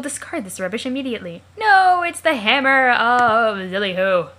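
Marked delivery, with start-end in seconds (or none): 1.6-4.3 loud, drawn-out heroic announcement; grand proclamation. Acted. Reader hails the legendary hammer, grandly proclaiming. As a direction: proclaim it slowly and forcefully.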